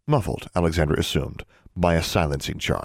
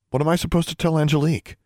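lightly voiced girl, higher pitch; questioning girl; 10 semitones higher, faster than usual. A narrator's reading. Girl asks what to tell Angelique, questioning. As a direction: ask it in a higher character voice.

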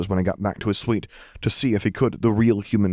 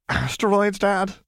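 put-on high, boyish teen retort; a teenage son correcting his dad, much higher; 12 semitones higher, slower than usual. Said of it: "said the tuft of hair".

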